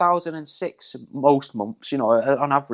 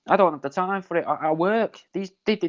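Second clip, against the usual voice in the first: usual speaking voice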